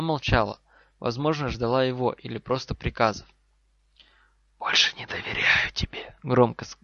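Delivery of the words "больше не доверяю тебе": forceful distrustful whisper; curt hiss. Acted. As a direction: hiss it briefly and firmly. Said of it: "коротко сказал Гарри".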